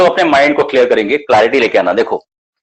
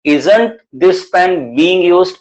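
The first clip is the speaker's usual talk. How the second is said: very slow, each word set apart; slow and clear; 2 semitones higher, much slower than usual. Shown as a demonstration. Teacher modelling a sentence for students, very slow. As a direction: model it slowly for the class. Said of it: "पहले धीरे बोलो please".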